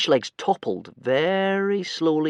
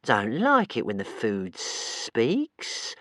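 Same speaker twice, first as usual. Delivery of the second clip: very slow complaining monster; slow sulky creature voice; whiny creature; much slower than usual. Acted. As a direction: whine it to itself. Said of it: "whined the creature".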